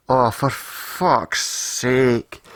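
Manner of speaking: very slow, drawn-out groan of exasperation; exasperated dread. Retold or imitated. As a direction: drag every word out, dreading the news. Dreading her bad news.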